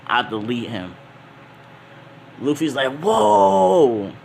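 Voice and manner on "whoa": high, loud astonished gasp of a word; amazed whoa. Acted. Luffy watching the Sea King vanish, loud and astonished. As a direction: blurt it out in awe. Luffy sees attack disintegrate foe.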